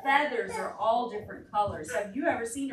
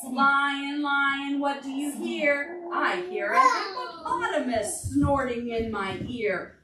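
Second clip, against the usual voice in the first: singsong refrain, a bit slow; storybook chant; reading to children; 3 semitones higher, 6 dB louder, slower than usual. Acted. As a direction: chant the book's refrain for toddlers. Reads picture book to children.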